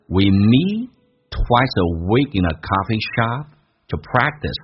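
slow model reading, broken after the opening words; drawn-out teacher's demonstration of the sentence; slow version. Shown as a demonstration. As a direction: read it slowly for learners, words linked within phrases. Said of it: "来看一下慢速版本".

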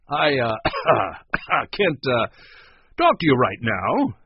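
loud coughs breaking up the words; coughing. A narrator's reading. Man covering a noise inside, coughing. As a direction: stall between fake-sounding coughs. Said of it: "cleared his throat loudly and began coughing".

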